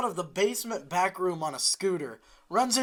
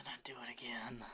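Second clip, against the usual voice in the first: unvoiced breathy whisper; 6 semitones lower, 19 dB quieter than usual. Real, not acted. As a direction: whisper it close to the mic.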